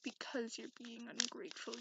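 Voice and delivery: ordinary talk, nothing marked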